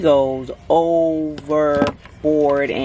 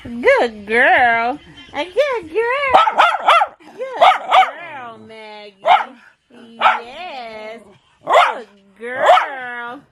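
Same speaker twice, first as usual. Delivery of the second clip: talking to a dog. Real, not acted. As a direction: coo at the pet in a falsetto. Praising the dog Maggie.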